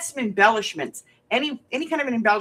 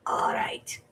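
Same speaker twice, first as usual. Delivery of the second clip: whispered aside; 5 dB quieter than usual. Real, not acted.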